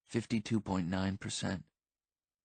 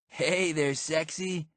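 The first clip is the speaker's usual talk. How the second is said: flirty come-on; 6 semitones higher, much slower than usual. A narrator's reading. Marty walks over to Violet for his kiss, flirty. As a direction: lay on a sleazy come-on.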